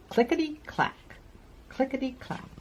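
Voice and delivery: sing-song clickety-clack like wheels; train rhythm. A narrator's reading. Train sound in story.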